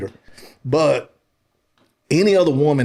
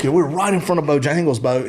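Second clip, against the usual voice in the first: ordinary talk, nothing marked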